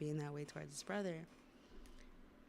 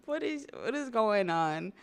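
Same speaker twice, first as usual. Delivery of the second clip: higher, louder, incredulous; amused disbelief; 6 semitones higher, 7 dB louder than usual. Real, not acted. Laughing at Luffy tortilla.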